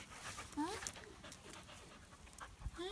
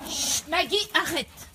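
loud, higher shushing; loud hush; 7 semitones higher, 10 dB louder, much slower than usual. Real, not acted. Owner hushing Maggie's barking, loud and higher. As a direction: shut the barking down.

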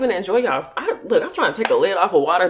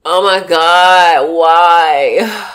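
drawn-out groan; 5 semitones lower, 8 dB louder, much slower than usual. Real, not acted. Groaning through the burn.

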